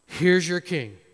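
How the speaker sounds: loud mocking jab; taunting. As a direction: dig it in with a sneer.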